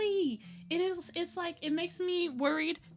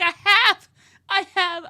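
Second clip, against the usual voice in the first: loud, higher insisting I have; indignant shout; 6 semitones higher, 14 dB louder than usual. Retold or imitated. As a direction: yell it back in protest. Frustrated retort to benefits letter.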